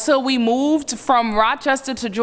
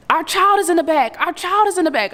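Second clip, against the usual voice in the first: high urgent shouting; frantic yelling; retold scream; 8 semitones higher, faster than usual. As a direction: cry out in panic for the child. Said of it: "until I heard him screaming".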